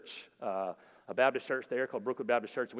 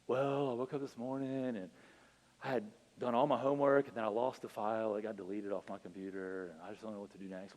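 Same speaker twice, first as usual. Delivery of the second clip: mopey; 4 semitones lower, 7 dB quieter than usual. Retold or imitated.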